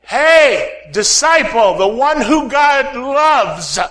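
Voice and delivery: loud call across a crowd, drawn out; yelled call. Retold or imitated. Preacher calling out to a disciple in a crowd, loud. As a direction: shout it down the street to a friend. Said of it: "I yelled out to you".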